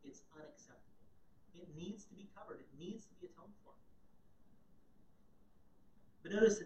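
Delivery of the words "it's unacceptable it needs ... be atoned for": God's verdict, much softer; quiet verdict. Retold or imitated. Preacher voicing God's verdict to listeners, softer. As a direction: lay down the verdict gently and softly. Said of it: "He looks at your sin and he says".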